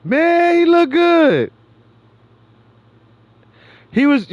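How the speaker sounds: high, loud amazed "man, he look good"; loud high-pitched praise; excited exclaim. Real, not acted. Admiring reaction to video.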